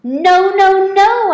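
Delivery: high, loud hamster squeak. A narrator's reading. Hamster protesting about the tadpoles, loud high squeak. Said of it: "I squeaked".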